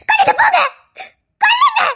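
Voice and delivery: wife crying and daring her husband to kill her; crying dare. Acted. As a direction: fling it at him through tears. Wife dares him, crying.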